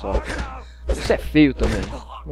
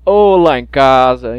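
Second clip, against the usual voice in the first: slow, louder leering catcall; catcalling worker; 10 dB louder, much slower than usual. Retold or imitated. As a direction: builder whistling at a passerby. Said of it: "o pessoal fala assim".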